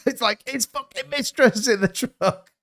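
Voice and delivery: slow, laughing through the words. Real, not acted. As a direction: say it while still cracking up. Laughs at own bit.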